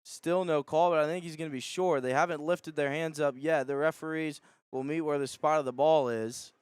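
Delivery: ordinary talk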